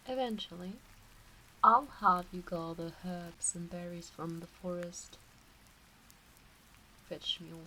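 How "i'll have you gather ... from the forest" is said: normal talking voice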